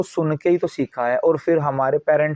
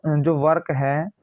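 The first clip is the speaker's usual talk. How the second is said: ordinary delivery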